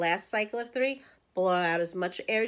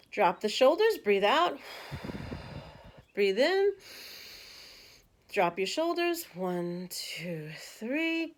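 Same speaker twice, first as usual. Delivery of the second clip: guided breathing cues; slower than usual. Shown as a demonstration. Coach leading viewers through a breath, lilting cues. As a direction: lead the breath step by step. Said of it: "guiding breathing exercise".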